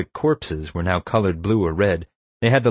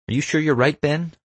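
higher-pitched, lightly voiced doubtful query; urgent questioning; 8 semitones higher than usual. A narrator's reading. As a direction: press for a sure answer, slightly anxious. Erica questions Ben before the shot.

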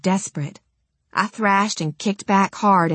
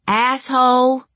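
higher, louder insult through pain; pained shout; 7 semitones higher, 4 dB louder, slower than usual. Acted. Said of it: "I shouted".

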